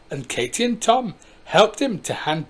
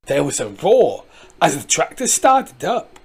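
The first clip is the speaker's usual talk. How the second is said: ordinary delivery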